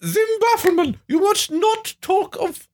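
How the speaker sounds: very high, wailing melodrama voice; high theatrical wail. Acted. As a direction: cry it out like a tragic lady. New dramatic character.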